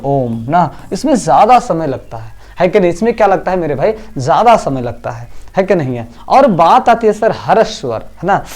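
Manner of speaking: ordinary delivery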